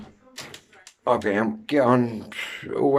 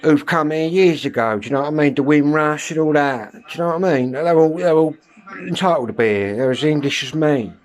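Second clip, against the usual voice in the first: louder and higher, getting worked up; ranting higher and louder to mates; heated rant; 6 semitones higher, 5 dB louder than usual. Real, not acted. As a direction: press the point hard and loud. Heated rant about London.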